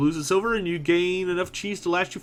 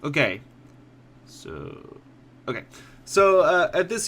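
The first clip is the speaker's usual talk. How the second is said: usual speaking voice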